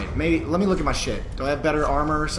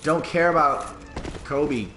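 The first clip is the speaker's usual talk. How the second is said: usual speaking voice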